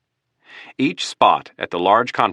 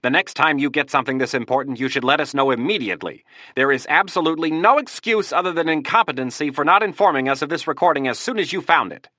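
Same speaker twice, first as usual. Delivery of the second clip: higher, rising angry scolding; raised voice; 8 semitones higher than usual. Acted. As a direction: lecture him with a raised voice. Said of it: "raising his voice".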